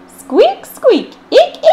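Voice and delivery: very high, loud squeak; squeaky eek. Acted. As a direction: squeak it like a mouse. Imitating squeaks.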